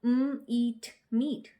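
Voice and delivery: म, ईट, मीट sounded apart, then blended; teacher sounding out मीट; sounding out a digraph. Shown as a demonstration. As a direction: break मीट into म and ईट, then join. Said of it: "इसी तरीके से".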